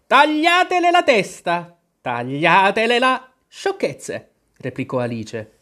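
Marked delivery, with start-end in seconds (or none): 0.1-3.3 shrieked high, loud, drawn-out order; a raging queen's piercing command; screaming. A narrator's reading. Enraged queen screams the execution order at the girl, screaming. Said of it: "cominciò a urlare". 3.5-4.3 loud and decisive. A narrator's reading. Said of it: "a voce alta e decisa".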